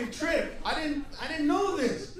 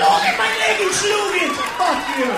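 loud, higher angry yell; furious shout; 5 semitones higher, 12 dB louder than usual. Retold or imitated. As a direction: blast him at full volume. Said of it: "I was like".

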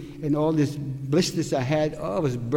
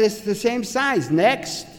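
higher-pitched brusque mock dismissal; retold dismissive camp worker; 6 semitones higher, slower than usual. Camp worker dismisses prisoner's complaint about clogs, retold brusque dismissal. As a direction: imitate a worker brushing off a complaint.

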